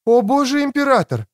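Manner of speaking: high-pitched woman's exclamation; exclaiming. A narrator's reading. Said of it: "воскликнула Креция".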